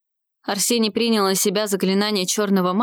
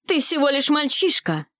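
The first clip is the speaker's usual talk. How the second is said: cried-out taunt; 6 semitones higher than usual. A narrator's reading. Dark mage answering young rival's boast, restrained cry. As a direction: exclaim it scornfully without full shouting. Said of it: "выкрикнул Филискатус".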